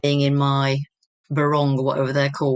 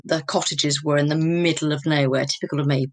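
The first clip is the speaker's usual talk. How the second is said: ordinary talk, nothing marked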